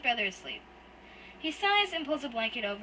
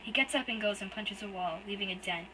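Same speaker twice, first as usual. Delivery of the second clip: ordinary delivery, nothing marked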